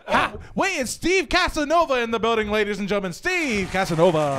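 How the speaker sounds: high, loud arrival announcement; hype announcer. Acted. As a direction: announce a star entering the building. Hyped announcement of arriving co-host.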